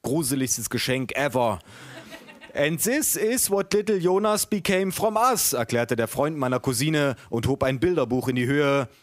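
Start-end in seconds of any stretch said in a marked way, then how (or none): 2.5-5.5 high-pitched, earnest bad English with German accent. A narrator's reading. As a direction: explain proudly in clumsy German-accented English. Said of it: "Er versucht, den Jungen bilingual zu erziehen". (Englisch mit deutschem Akzent, erklärend)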